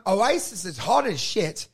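normal talking voice

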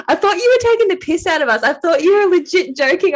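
high, loud stunned disbelief; retelling her shock at the news, high and loud. Retold or imitated. Friend reacting to pregnancy news in high, loud disbelief.

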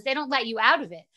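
ordinary talk, nothing marked

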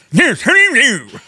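badly overdone posh British, high and loud; hammed-up high British posh voice; posh British accent. Student repeats after accent software, badly exaggerated posh British accent. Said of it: "Posh British accent".

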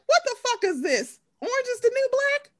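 high-pitched, incredulous retelling; mock disbelief. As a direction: imitate a baffled onlooker, pitch climbing at the end. Acting out shocked onlookers' reaction.

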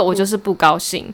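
ordinary talk

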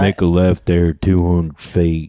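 low slow soothing cowboy drawl; Sam Elliott GPS. Retold or imitated. Imagining Sam Elliott as the GPS, low and slow. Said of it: "that soothing voice would be perfect".

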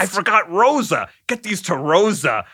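rising, teasing, laughing mock voice; mocking Rosa quote. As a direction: ham up the mystery of Rosa. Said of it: "we've talked about Rosa quite a bit".

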